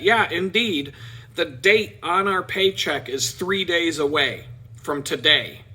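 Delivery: ordinary talk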